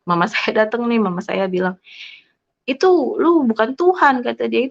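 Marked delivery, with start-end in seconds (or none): 2.7-4.2 higher-pitched, slow mock protest; imitated objection. Mother disputing her child's faith, imitated objection.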